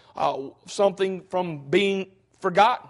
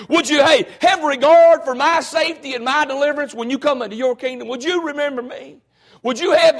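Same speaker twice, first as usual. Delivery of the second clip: raised, higher preaching voice; 4 semitones higher than usual. Acted. Preacher pleads before his congregation in full sermon voice.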